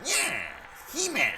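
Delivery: very high squeaky cartoon villain; Skeletor voice. Skeletor going on about He-Man, squeaky villain voice.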